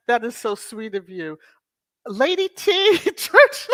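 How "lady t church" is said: high, loud laugh through name; laughing shriek. Real, not acted. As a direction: crack up saying it.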